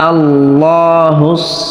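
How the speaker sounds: slow melodic recitation; recited slowly. Shown as a demonstration. Teacher modelling the verse for students, slow chant. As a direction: draw out قل هو الله like tilawa.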